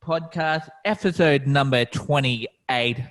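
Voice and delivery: high, loud opening greeting to listeners; podcast host intro. Acted.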